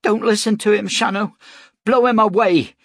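high, loud desperate cry. A narrator's reading. Hostage woman urging the gunman to shoot, shouting. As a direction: scream it out to him, defiant. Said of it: "cried Beth McAdam".

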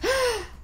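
a sharp high gasp of delight; gasp, thrilled and proud; excited gasp. A narrator's reading. Rabbit gasps with delight at his big job.

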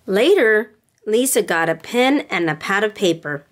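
gentle storybook read-aloud with voices; storybook reading for little girls; reading to children. A narrator's reading. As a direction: read it like bedtime story time. Reads picture book to girls.

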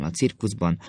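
ordinary delivery

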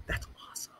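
imitated hushed whisper of awe; whispered reaction. Wife reacts to her husband's story, in a hushed whisper. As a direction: whisper it, almost all breath.

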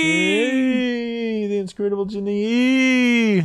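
high, drawn-out spooky moan; haunt the name; ghostly voice. Acted. Echoing donor name spookily.

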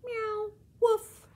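high, loud squawky meow and woof; a parrot imitating a cat and dog; parrot mimicking animals.

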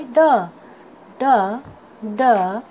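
ड as a lone "duh", with pauses; phonic letter sound. Shown as a demonstration. Teacher showing students the sound of D, phonic letter sound. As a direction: sound out ड as D starts "dog". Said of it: "डी का sound होता है".

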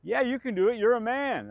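high, loud encouraging coach; upbeat pep talk from the dance captain; quoting Roger. Retold or imitated. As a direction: cheer him on, mock hearty. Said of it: "he says".

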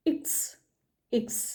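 ഇക്സ് said as German "iks"; German letter name. Shown as a demonstration. Teacher modelling the German letter X. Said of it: "എക്സിനെയാണ് ഇക്സ് എന്ന് പറയുന്നത്".

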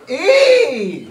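high, loud laughing squeal; excited squeal. Real, not acted. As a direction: shriek with glee. Shriek then laugh at end.

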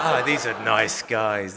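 mock naive cheer, laughing at yourself; naive delight. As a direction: ironic gushing take. Naive thought, then repeated.

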